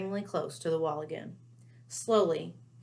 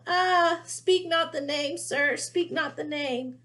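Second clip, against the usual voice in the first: high, louder, slow moaning plea; a terrified elf wailing, slowly; moaning house elf; 9 semitones higher, 6 dB louder, slower than usual. Acted. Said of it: "clapped his hands over his bat ears and moaned".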